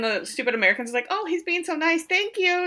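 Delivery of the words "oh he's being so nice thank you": much higher, naive and sugary; gushing tourist voice. Retold or imitated. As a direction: coo it like a clueless tourist. Stupid Americans thanking Lars.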